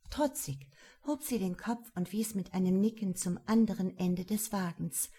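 usual speaking voice

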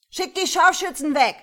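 high, very loud yell; shouted order. A narrator's reading. Robber to police about the snipers, shouting. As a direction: shout a demand across the square.

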